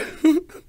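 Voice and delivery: high squealing laugh; a shrieking laugh that trails off. Real, not acted. Laughing after rant.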